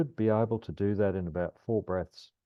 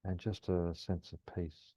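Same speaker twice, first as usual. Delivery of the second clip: calm grounding exercise. Acted. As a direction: ease them gently through the breath. Guides a grounding meditation.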